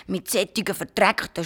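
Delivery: usual speaking voice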